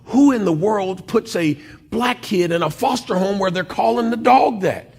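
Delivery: high, incredulous outrage, retold; exasperated, high-pitched protest; frustrated and mad. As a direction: demand an answer, pitch climbing and slowing at the end. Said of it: "I'm frustrated and mad".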